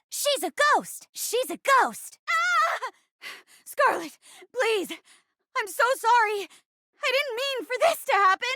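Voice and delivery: high, frightened cries; stagey panic. Acted. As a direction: cry out in theatrical terror. Terrified apology to a ghost.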